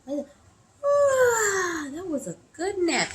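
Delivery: high contented sigh easing into speech; proud and satisfied. A narrator's reading. Peddler to himself after a nap, proud. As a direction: wake up pleased, pitched well up. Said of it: "he proudly said to himself".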